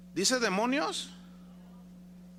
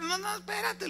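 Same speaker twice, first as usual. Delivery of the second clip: sad devil. Retold or imitated. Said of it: "está rep".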